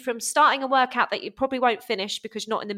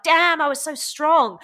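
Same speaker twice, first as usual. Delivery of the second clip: mock amazement; 6 semitones higher, 6 dB louder, slower than usual. Voices a lifter proud of strength.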